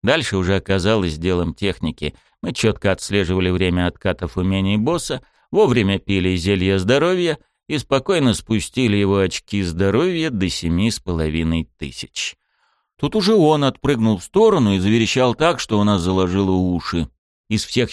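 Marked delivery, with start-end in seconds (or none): none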